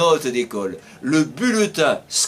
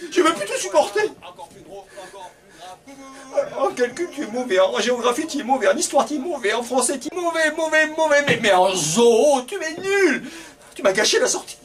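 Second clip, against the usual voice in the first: higher, scolding teacher listing bad grades; 8 semitones higher, faster than usual. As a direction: tell him off, much higher.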